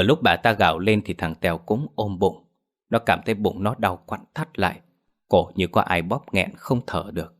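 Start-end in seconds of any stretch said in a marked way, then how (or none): none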